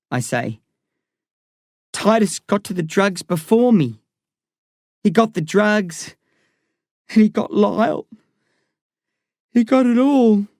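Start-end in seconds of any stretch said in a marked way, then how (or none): 1.9-10.5 rising higher, voice cracking toward despair; despairing boy. A narrator's reading. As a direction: climb higher with each line, losing hope. Eli despairs on phone.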